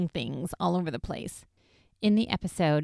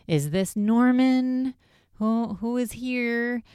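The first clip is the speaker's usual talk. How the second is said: high, drawn-out call into the dark; calling to spirits; 6 semitones higher, 4 dB louder, slower than usual. Retold or imitated. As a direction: act out a ghost hunter calling out slowly.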